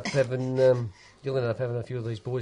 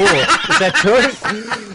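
high, slow question broken by laughter; laughing disbelief; 11 semitones higher, 12 dB louder, much slower than usual. Real, not acted. Asking a mate if the story's true, laughing. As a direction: laugh your way through the question.

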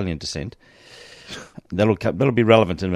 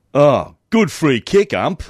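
slow, loud sarcastic praise; sarcasm; 6 semitones higher, 7 dB louder, slower than usual. Retold or imitated. Player mocking the umpire's decision, sarcastic. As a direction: drag it out, dripping with sarcasm.

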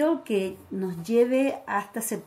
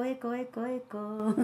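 fading echo, each repeat softer; last word echo; 10 dB quieter than usual. Pretend. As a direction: echo the word as it dies away. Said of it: "Me gusta ese corito con eco".

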